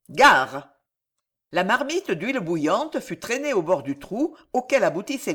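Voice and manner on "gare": loud, low warning cry; warning shout. A narrator's reading. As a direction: bellow a short watch-out. Warning before pouring boiling oil. (cri d'avertissement)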